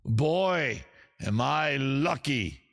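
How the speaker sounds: hammed-up recital, swelling and sinking; over-the-top British poet. Poet reading Bathroom Wall lyrics as verse, theatrical British poetry voice. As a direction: declaim rock lyrics like solemn verse. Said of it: "my poetry voice".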